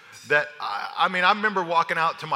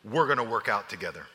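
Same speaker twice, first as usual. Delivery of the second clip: low, firm; 7 semitones lower, 4 dB quieter than usual. Retold or imitated. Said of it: "he says".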